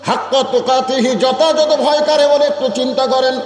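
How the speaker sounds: high, ringing sermon chant; impassioned preaching. Acted. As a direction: preach it high, stretching the phrase endings. Preacher at full pitch.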